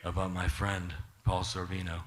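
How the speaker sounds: low, slow mobster-style warning; imitated intimidating request, deliberately paced; quiet menace. Imitating an actor's mob-style threat.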